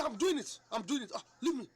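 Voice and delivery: high, loud, fed-up brush-off; irritated shout. Retold or imitated. Wife fending off her nagging husband, loud and fed up. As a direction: snap back loudly like a nagged wife.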